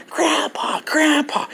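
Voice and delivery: imitating a child's high-pitched calls; mimicked child's excited yell; screaming granddaughter. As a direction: cry out the name high, as if running over. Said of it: "she's screaming my name".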